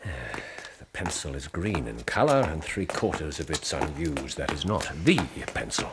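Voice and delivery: low, hushed deduction; low murmur. Real, not acted. Holmes murmurs his deductions to Watson, low and hushed. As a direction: keep it low and private between you two.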